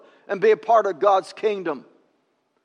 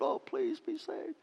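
high, hushed beggar's plea; mock pleading; 9 semitones higher, 13 dB quieter than usual. Preacher mimicking a begging Lord, high soft pleading. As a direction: plead softly in a small, high voice. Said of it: "begging and pleading with them".

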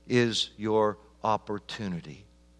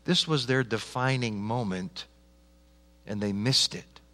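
ordinary talk

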